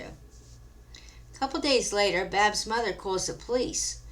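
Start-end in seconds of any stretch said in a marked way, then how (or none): none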